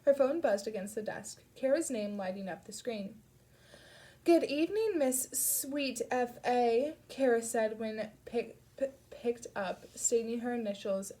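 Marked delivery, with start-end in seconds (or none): 4.2-6.9 high, slow, hammed-up bad British accent; a mock-posh British put-on, drawn out and silly; terrible British accent. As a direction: greet her friend in a deliberately awful British accent. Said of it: "in a terrible British accent".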